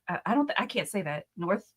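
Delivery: normal talking voice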